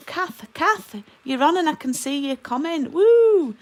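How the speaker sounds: high, loud whooping welcome; excited greeting. Real, not acted. Host spotting a friend joining the livestream, excited. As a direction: call out to friends with delight, ending on a whoop.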